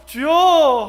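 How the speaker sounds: loud demonstrative cry; shouted call. Acted. Church member arguing one cry is enough, loud call. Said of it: "'주여' 하고 외치면 되지".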